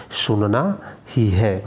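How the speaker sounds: सुनना ही है slowly with ही weighted; slow stress on ही. Shown as a demonstration. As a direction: drill ही slowly for the class. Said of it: "क्या करना है".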